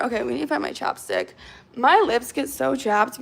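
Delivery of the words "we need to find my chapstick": normal talking voice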